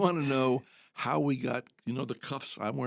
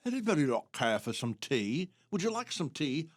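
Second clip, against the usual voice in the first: imitated British tea server; British accent; 4 semitones higher than usual. British man offering tea in camp, put-on British accent.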